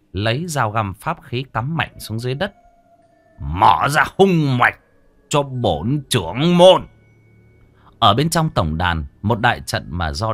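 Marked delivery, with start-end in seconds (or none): 3.4-6.9 loud, slow and ringing; slow booming command. Acted. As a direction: call it out like a ritual command. The sect leader invokes a spell.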